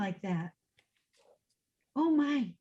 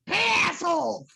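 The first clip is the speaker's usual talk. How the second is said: space witch voice; 3 semitones higher, 5 dB louder, slower than usual. Acted. Mom uses witch voice command.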